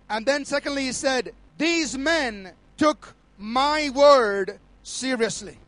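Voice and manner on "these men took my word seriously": very slow, deliberate quoted decree; slow, emphatic pronouncement. Retold or imitated. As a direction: weigh each word as God's message. Voicing the Lord's second solemn statement.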